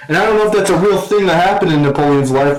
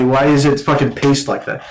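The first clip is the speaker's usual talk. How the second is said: normal talking voice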